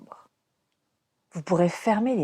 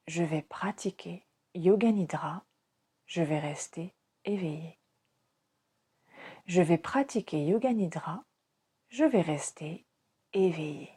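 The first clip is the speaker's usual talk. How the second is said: softly repeated resolve, as if to oneself; soft inward repetition; 2 semitones lower, 6 dB quieter than usual. Shown as a demonstration. Teacher models a silent resolve for listeners, soft inward repetition. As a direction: say it softly, like a mental mantra. Mantra to repeat mentally, said softer.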